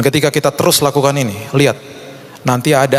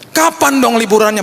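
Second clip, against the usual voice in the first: imitated high, pleading child; eleven-year-old child; 10 semitones higher than usual. Child asking Dad for the holiday, imitated high plea. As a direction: ask like a child longing for a holiday. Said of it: "sederhana dari anak sebelas tahun".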